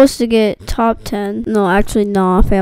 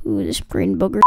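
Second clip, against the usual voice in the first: ordinary talk, nothing marked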